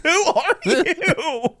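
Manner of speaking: high, drawn-out incredulous cry; mock disbelief. Fan reacting to an actor's unexpected voice, in laughing disbelief. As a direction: stretch it out high, half laughing.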